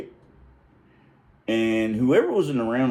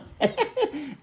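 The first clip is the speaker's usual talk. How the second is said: high burst of real laughter; high giggling laugh. Real, not acted. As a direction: crack up laughing.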